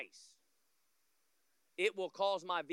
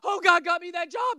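loud, raised amazed exclamation; mock surprise; 9 semitones higher, 8 dB louder than usual. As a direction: act stunned at the blessing. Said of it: "we're shocked".

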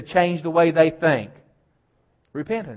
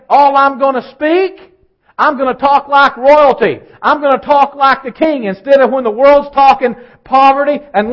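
loud, higher, driving sermon delivery; full force preaching; 6 semitones higher, 6 dB louder than usual. Acted. Pastor exhorting the church to speak the Word, fervent. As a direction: preach it hard to the congregation.

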